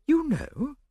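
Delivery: a shocked, high gasp of a question; startled gasp. A narrator's reading. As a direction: catch your breath on it. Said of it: "she gasped".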